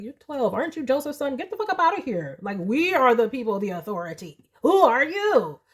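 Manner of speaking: put-on high, scornful put-down of a cheeky kid; mocking temple elders. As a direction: sneer at the boy from above. Temple elders mocking young Jesus.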